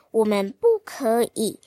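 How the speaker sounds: ordinary delivery, nothing marked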